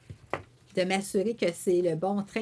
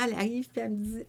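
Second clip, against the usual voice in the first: normal talking voice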